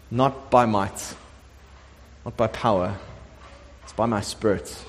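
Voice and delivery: low, soft scripture quote with long pauses; hushed, solemn. Acted. Preacher voicing God's word to Zerubbabel, hushed and solemn. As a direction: let each phrase settle quietly.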